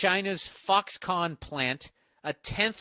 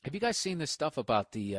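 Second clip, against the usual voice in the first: ordinary talk, nothing marked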